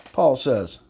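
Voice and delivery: ordinary delivery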